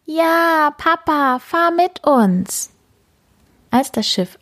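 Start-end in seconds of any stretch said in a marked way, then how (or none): 0.0-2.7 high, eager pleading call; begging father to come along; excited kitten. A narrator's reading. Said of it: "miaut gleich begeistert". (begeistert, hohe Kätzchenstimme)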